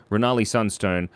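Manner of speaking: ordinary talk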